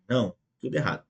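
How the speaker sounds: ordinary talk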